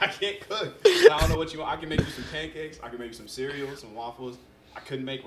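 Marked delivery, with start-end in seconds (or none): 0.0-2.8 flustered plea to sister. Retold or imitated. Telling hungry little sister he can't cook.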